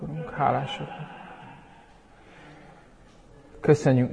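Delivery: soft, quiet closing prayer. Real, not acted. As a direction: pray softly to God, unhurried. (halk, csendes ima)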